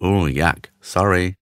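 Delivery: low, subdued sheepish apology; small, embarrassed mumble of an apology. A narrator's reading.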